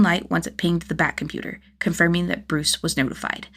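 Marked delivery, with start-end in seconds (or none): none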